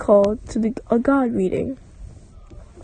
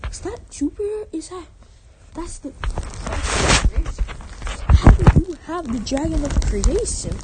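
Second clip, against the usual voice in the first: shocked; 4 semitones higher than usual. Acted. Overseer god shocked at dragon.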